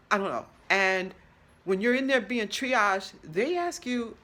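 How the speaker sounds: ordinary talk, nothing marked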